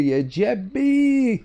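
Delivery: very high, long stretched shout; drawn-out cry. Real, not acted.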